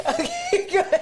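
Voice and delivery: high, giggling words; laughing. Real, not acted. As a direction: talk through giggles. Laughing through the line.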